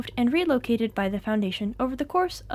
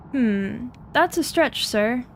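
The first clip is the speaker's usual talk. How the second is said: ordinary delivery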